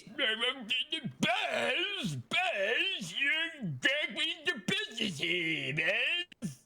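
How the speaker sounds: high, slow honking walrus voice. Retold or imitated. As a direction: bellow the name like a walrus. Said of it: "It sounds like this".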